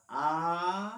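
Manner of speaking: आ stretched long and loud, three beats; pluta call. Shown as a demonstration. Teacher demonstrating pluta, a long loud call. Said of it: "तीन मात्रा".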